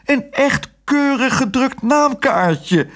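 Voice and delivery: very high, drawn-out whine; desperate wail. A narrator's reading. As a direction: wail it slowly in helpless protest. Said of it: "jammerde de zuster wanhopig".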